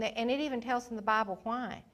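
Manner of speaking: ordinary talk, nothing marked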